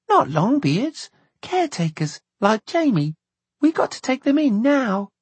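put-on high-pitched child's voice; insistent little girl. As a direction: explain urgently as a small girl, high.

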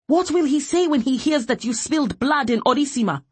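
high, forceful accusing question; defiant challenge. A narrator's reading. Woman to officer spilling blood, defiantly challenging. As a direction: throw the threat back at him.

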